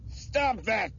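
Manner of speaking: high, frantic shouted order; angry shout. A narrator's reading. Craig shouts at struggling hostage Bethany, angry shout. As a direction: shout it in panicked anger. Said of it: "Craig shouted".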